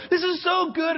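high, thrilled exclamation; extreme excitement. Retold or imitated. Preacher reliving joy at deliverance, excited high cry. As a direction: burst out with joy, voice way up. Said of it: "I just had this extreme excitement".

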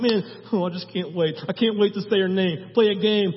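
can barely wait, bubbling over; playful Jesus. Retold or imitated. Playful Jesus eager to call Mary's name, excited. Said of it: "this playful Jesus who's like".

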